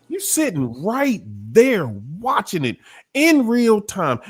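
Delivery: high, swooping preacher delivery; incredulous preaching. Acted. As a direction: preach it in rising disbelief, stretching key words.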